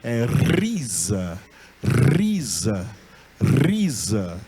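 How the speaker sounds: risa with a trilled rr; rolled r in risa. Shown as a demonstration. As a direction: roll the r of risa firmly. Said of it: "não é risa, é risa".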